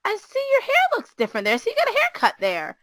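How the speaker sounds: put-on light English accent, pitched high; a gentle, high English dad noticing. Dad teasing his daughter about her haircut, light English accent. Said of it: "he has an English accent".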